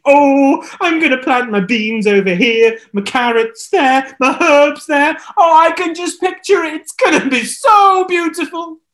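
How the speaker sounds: high, loud dreamy delight; super excited. Acted. Ahab picturing his garden, high excited gushing. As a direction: gush over the garden. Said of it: "super excited".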